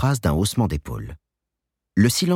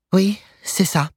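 put-on young woman voice; 13 semitones higher, slower than usual. A narrator's reading. Joanne answers in story.

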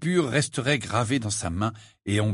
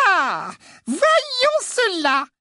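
high, slow, sugary sweet voice; syrupy menace, drawn out; wicked stepmother; 4 dB louder, much slower than usual. A narrator's reading. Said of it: "de sa voix doucereuse".

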